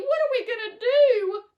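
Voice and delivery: high, loud despairing wail; a mother wailing about her shrunk son. A narrator's reading. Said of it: "wailed Missus T V".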